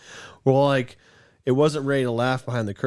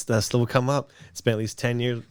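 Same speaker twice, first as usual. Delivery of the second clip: ordinary talk, nothing marked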